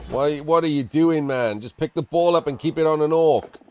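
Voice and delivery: exasperated. Real, not acted. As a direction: vent at the bad play. Exasperated at player's bad move.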